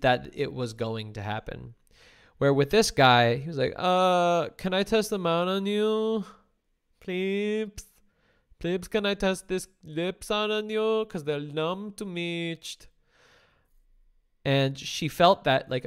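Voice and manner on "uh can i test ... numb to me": higher, slow, begging and unsure; unsure pleading. Retold or imitated. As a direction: plead like you doubt she'll agree. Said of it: "he was already pleading with her".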